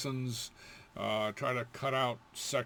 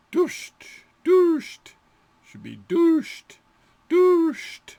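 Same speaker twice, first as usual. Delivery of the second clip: loud shushing call; 14 dB louder, much slower than usual. Shown as a demonstration. Teacher calling out a loud hush command. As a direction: call out dusht sharply, high and loud.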